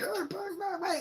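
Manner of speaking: very high squeaky gibberish; high-pitched blah-blah stand-in for urging; mock frantic babble. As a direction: imitate panicked jabbering. Retold frantic urging at the door.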